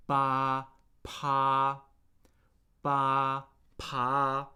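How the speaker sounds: "Ba" and "pa" held on one level pitch; first tone. Shown as a demonstration. Teacher drilling students, level first tone on "Ba, pa". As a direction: keep each of "Ba, pa" long and level. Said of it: "That's the first tone".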